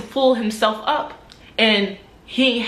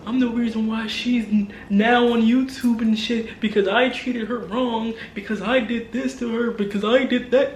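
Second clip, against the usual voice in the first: a hint of whiny mimicry of her; mocking imitation. Imitating the ex's friend, lightly mocking whine. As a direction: mimic her self-pitying claims lightly. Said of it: "you sound delusional".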